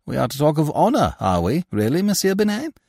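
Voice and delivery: amused taunting of Binet; mocking laugh in the voice. A narrator's reading. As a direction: scoff at his talk of honour. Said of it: "André Louis laughed".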